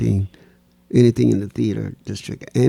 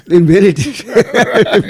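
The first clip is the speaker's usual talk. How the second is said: bursting into laughter, higher and louder; laughing; 7 semitones higher, 8 dB louder, much slower than usual. Real, not acted. Laughing at his own naive question about dance. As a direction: laugh the words out at yourself.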